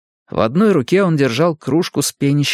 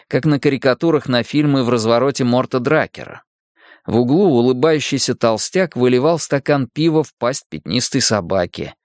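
ordinary delivery, nothing marked

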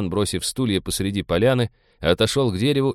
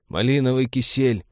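high, pained groan; groaning navigator; 6 semitones higher than usual. A narrator's reading. Navigator groaning from under the table, pained. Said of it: "простонал навигатор из-под стола".

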